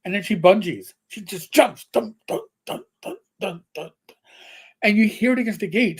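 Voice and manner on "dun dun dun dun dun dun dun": dun-dun-dun, falling away softly; imitated bouncing thuds. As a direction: bounce each thud, fading out. Imitates cat bungee jumping on gate.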